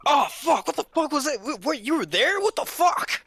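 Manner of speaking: high, loud panicked cursing, hammed up; mock startled yelling. Retelling a tripped recruit's freakout: mock panicked high yell. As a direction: imitate a guy freaking out after tripping.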